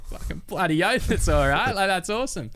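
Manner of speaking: overjoyed. Retold or imitated. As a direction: shout it like you just got your dream seat. Accepting the drive, overjoyed.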